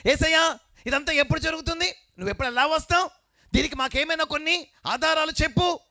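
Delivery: high, loud preaching cry; full-force preaching. Acted. Preacher voicing disciples, full force. (పూర్తి శక్తితో ప్రసంగం)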